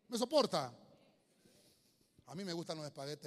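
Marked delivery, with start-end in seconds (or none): 0.1-0.7 louder plea. Real, not acted. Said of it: "hermano, ¿me soporta?".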